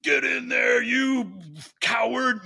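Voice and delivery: bully someone into the ice bath; mock drill-sergeant shout; mock yell. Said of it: "Nobody gets to say".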